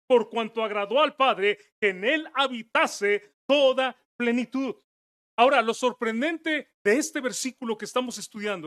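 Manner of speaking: sermon at full voice; fervent preaching. Acted. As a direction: preach it out to the congregation.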